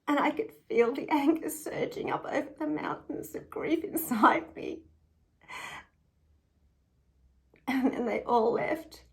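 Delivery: loud, anguished telling; surging grief and anger. Retold or imitated. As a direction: push the words out through rising grief. I could feel the anger surging up.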